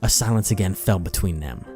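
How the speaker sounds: ordinary delivery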